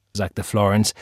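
plain reading voice

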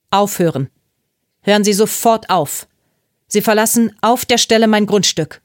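loud order, building in force; angry command. A narrator's reading. Mrs. Dragon ordering Lucas off her property, angry command.

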